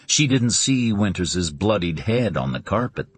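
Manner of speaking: ordinary delivery, nothing marked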